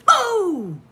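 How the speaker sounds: sudden high loud BOO; shouted boo. Acted. Preston scaring a shopkeeper with a loud boo. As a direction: jump out and yell it. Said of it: "says Preston".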